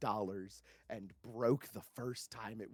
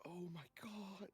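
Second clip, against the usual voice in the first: whispered; 6 semitones higher, 13 dB quieter than usual. Real, not acted. Stunned reaction.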